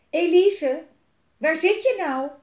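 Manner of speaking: loud, high searching call. A narrator's reading. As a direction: call for someone who is missing. Jelmer calls again for Elise.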